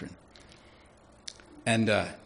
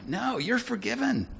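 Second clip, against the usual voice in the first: bright reassurance; 13 semitones higher, slower than usual. Shown as a demonstration. Pastor declaring forgiveness warmly.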